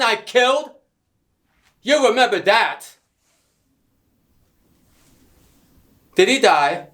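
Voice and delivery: furious yell, high and loud; shouting. Acted. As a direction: scream it across the camp. Villain shouting at captive.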